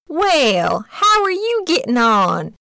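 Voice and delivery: put-on high, airy old duck asking kindly; high fluttery old lady duck; old duck visitor. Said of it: "asked the old duck".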